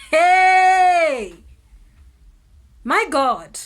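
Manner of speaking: sudden high, loud cry of alarm; startled shout at the mother; shocked yelp. Retold or imitated. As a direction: jump out of your skin.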